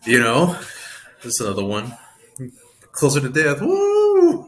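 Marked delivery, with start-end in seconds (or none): 2.9-4.5 high, loud sarcastic whoop; mock cheer. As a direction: cheer it like it's good news. Mock cheer about death.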